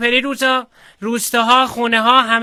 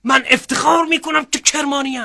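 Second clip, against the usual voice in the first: growing proud slogan, rising higher; proud declaration; 4 semitones higher than usual. Pretend. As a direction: build it up like a rally cry. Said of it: "یه مشت گره کرده ای برام بکش".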